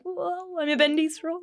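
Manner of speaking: high, breathy dazed voice; wobbly and stunned, like a bendy straw; dazed new mum. Retold or imitated. New mum handed her baby, dazed and stunned.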